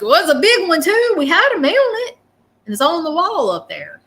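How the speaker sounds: high, excited grandpa impression; sweet grandfather. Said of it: "He's like".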